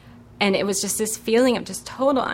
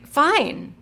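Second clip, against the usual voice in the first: frustrated; 9 semitones higher, 4 dB louder than usual. Retold or imitated. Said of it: "I just got really frustrated".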